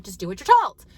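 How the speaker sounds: mild mock yell at the kids; retold yelling. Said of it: "So I was yelling".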